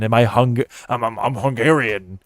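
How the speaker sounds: normal talking voice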